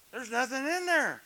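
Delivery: ordinary talk, nothing marked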